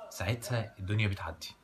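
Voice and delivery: ordinary delivery, nothing marked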